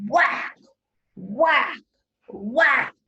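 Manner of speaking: punchy, loud axe-blow sound words; loud whacks. Acted. Teacher reading to class, loud axe whacks. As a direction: hit each whack like an axe. Axe strikes in read-aloud.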